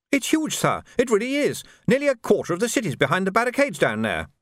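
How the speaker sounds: high-pitched, agitated trooper; breaking big news to an officer; urgent report. Acted.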